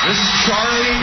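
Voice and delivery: high, loud booming announcer voice; announcer intro. Shown as a demonstration. Host gives Charlie a loud booming intro. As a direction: boom the name out like a big introduction. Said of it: "you deserve this".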